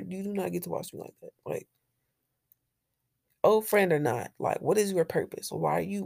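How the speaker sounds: ordinary talk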